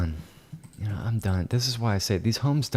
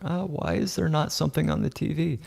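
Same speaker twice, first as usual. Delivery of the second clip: lightly mocked complaining client, higher pitch; mimicking a client; 7 semitones higher than usual. Realtor mimics client asking about the TV, mock complaint. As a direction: imitate her question in a higher voice. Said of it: "I'm gonna hear from her".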